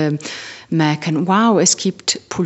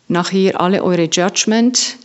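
ordinary delivery

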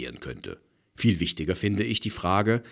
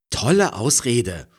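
calling out; 7 semitones higher, 9 dB louder than usual. A narrator's reading. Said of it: "ruft Frederike".

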